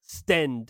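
stend said as stend, not stand; Stend pronunciation. Shown as a demonstration. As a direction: say understand as unders-tend. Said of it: "S T E N D".

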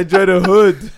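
high, loud, laughing through the words; mock posh voice while laughing. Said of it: "She's like".

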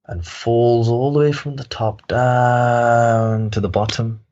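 slow storyteller's plunge, "down" held and held; drawn-out falling. Acted. Telling listeners how the parrot falls from its perch, drawn-out. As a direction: stretch "down" out long, like a long drop.

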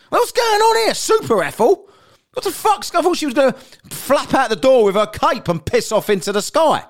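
very high, loud incredulous rant; hammed-up astonishment. Teller amazed at Super Ethel's strength, hammed-up astonishment. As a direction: exclaim in comic disbelief, pitch soaring.

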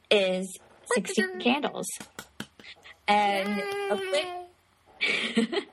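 normal talking voice

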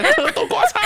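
very high laughing fake alarm; high laughing mock panic; mock panicked yell. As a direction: squeal it while pushing through. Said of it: "把前面的全部推开".

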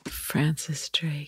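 soft breathy whisper, slowed; hushed slow whisper of the name. Real, not acted. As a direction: whisper the answer like a secret. Guessing the letters aloud.

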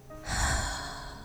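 long, soft sighing out-breath; audible exhale. Shown as a demonstration. Meditation guide showing how to let go, sighing exhale. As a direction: let the held breath go in a sigh.

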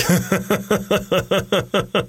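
wheezy Seth Rogen-style chuckle; Seth Rogen laugh. Shown as a demonstration. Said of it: "my laugh was probably like".

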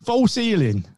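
high southern English "false healing"; mock southern English. Mate repeating the force healing tease, mock southern English accent. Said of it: "put any southern accent on".

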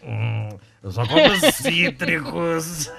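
slow put-on friend's voice; Jorge's voice. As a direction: drawl it like a slow, smug friend. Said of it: "A voz do Jorge".

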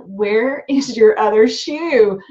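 slow, loud, mock-stern question to her son; slowed-down exasperated parent voice; exasperated mom. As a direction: hammer out each word at the kid. Mom confronting son at car.

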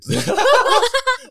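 loud high burst of laughter; real high laughing burst. Real, not acted. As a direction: laugh hard at yourself. Laughing at his own boast.

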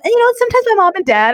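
put-on high, innocent child voice; mimicking a little girl. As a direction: play the kid spilling family secrets. Quoting a child's revelation.

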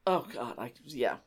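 normal talking voice

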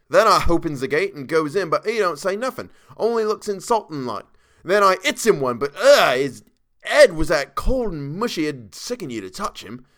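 loud, high Cockney storyteller, disgusted; worked-up Cockney servant; Cockney dialect. A narrator's reading. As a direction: recoil in Cockney as you tell it. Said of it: "Thomas's Cockney dialect".

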